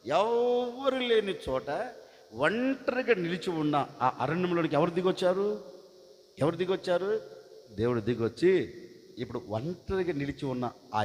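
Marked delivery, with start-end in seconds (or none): none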